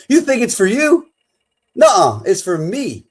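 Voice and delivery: high, loud teasing "you think it's for you?"; a cheeky, sing-song jab at the listener; playful teasing. Pretend. Mock teasing question to listeners.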